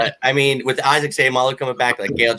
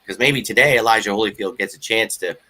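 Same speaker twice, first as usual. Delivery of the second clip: normal talking voice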